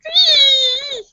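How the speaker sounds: high, loud squeal of alarm; high loud cartoon penguin squeal. Penguin car breaks down.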